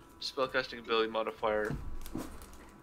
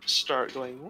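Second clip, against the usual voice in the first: ordinary delivery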